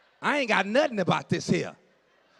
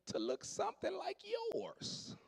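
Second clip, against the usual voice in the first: imitating the lady; 12 semitones higher, 14 dB quieter than usual. Imitating the lady's wish.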